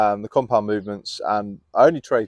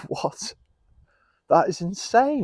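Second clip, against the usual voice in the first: shocked disbelief; 11 semitones higher, slower than usual. Real, not acted. Shocked at the porn story.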